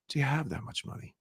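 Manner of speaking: barely press, keep it soft; soft delivery. Shown as a demonstration. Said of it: "hear the sound of my voice".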